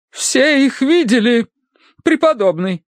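high, slow emphatic appeal; insistent wife. Acted. Wife calls on priest as witness, insistent.

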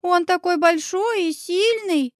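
put-on high, slow child voice; small son impressed by a grown-up; little boy admiring. A narrator's reading. Young son admires the neighbour.

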